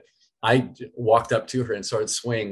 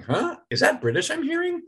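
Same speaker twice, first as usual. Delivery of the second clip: puzzled fan. Retold or imitated. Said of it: "everyone's kinda going".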